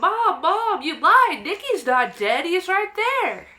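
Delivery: put-on high, loud, excited kid calling Mom; a child yelling to Mom that the dead dog is back. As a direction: yell for Mom as an astonished child. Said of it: "that's why I'm yelling".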